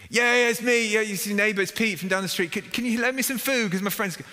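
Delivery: high, loud eager answer; eager plea. Acted. Villager answering the neighbour through the door, high eager pleading. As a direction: rush to explain yourself, hopeful.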